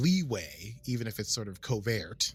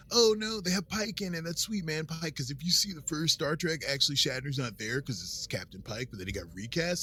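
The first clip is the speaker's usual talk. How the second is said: higher-pitched laid-back bro voice; surfer-dude bro; 6 semitones higher than usual. Retold or imitated. Nerd explaining Pike to his buddies, in bro voice. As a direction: put on a cool high-school bro talking Star Trek. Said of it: "They talk like this".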